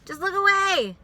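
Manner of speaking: high, loud exasperated yell; loud fed-up outburst; exasperated shout. Real, not acted. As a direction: shout it out in frustration.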